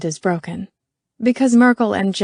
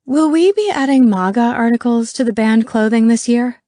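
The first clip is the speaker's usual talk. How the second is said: loud, higher, projected query; pointed public question; 4 semitones higher, 7 dB louder than usual. A narrator's reading. Merkel raises the band clothing in a meeting, loudly pointed. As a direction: pitch it up to the whole room.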